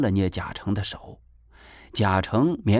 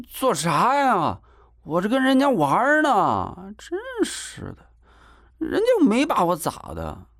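put-on whiny boy protesting; 10 semitones higher than usual. A narrator's reading. Boy wants sister to stay, whiny protesting. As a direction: brush it off in a high whine.

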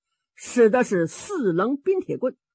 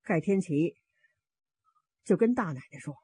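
ordinary delivery, nothing marked

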